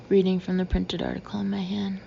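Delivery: ordinary delivery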